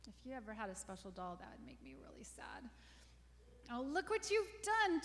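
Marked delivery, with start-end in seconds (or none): 3.7-5.0 high, loud anguished yell. A narrator's reading. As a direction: yell at your sister. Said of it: "Julie yelled".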